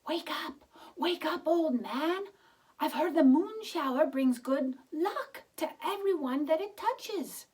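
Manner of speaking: put-on high, loud, urgent old woman; eager high voice of an excited old wife; urgent wake-up call. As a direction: rouse the sleeping husband. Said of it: "The old woman shook her husband".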